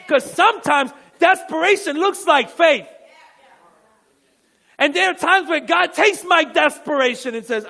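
loud, high, emphatic sermon delivery; full-force preaching. Real, not acted.

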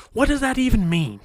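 indignant outburst. Real, not acted.

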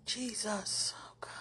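breathless whispered prayer. Real, not acted. Whispering a plea to Jesus while overwhelmed. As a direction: whisper the name, spent.

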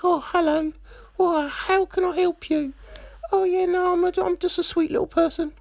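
mocking a soft-spoken sweet character; sweet soft-spoken girl. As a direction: play the naive sweet girl, all sugar. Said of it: "a character and they speak very softly".

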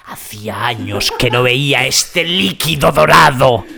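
voz impostada, lenta, que va subiendo con asombro; peregrino maravillado. Acted. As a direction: como quien contempla un tesoro perdido, alargando las palabras. Acted pilgrim marvelling at the oil. (peregrino postapocalíptico maravillado, voz lenta y teatral)

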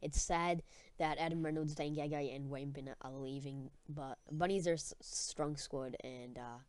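ordinary talk, nothing marked

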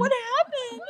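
very high, wailed question; mock-tearful panic. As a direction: retell it as a distraught, high-pitched cry.

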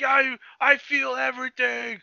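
high, slow hammed-up villain; drawn-out mock grandiosity; exultant villain. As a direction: imitate a villain drunk on power. Villain basking in power, retold.

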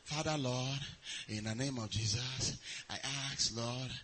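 soft, limp mock prayer; half-hearted prayer. Preacher mocking a lukewarm believer's prayer, softly. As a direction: mumble it like you don't mean it. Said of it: "you see some people".

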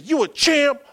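high, loud call to his little son; playful father hype. Retold or imitated. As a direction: pump up the toddler like a coach. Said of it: "I said".

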